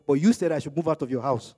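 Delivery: normal talking voice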